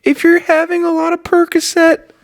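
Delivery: put-on whiny little boy. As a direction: whine it way up high, dragging the words. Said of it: "doing a whiny little".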